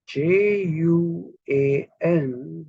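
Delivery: normal talking voice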